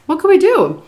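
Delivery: high, loud what-could-we-do; helpless rhetorical question. Retold or imitated. Storyteller to viewers about watching helplessly, rhetorical. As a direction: toss it out high and emphatic.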